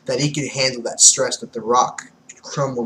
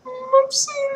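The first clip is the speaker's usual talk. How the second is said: very high meek apology; tight-lipped squeak; much faster than usual. Acted. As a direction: squeak it, cowed and lips pressed. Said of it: "Caesar gets all tight-lipped".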